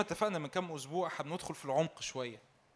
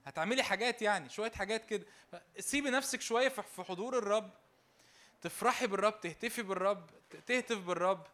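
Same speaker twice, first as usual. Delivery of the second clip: excited urging; 5 semitones higher, 5 dB louder than usual. Real, not acted. Preacher urging people to rejoice.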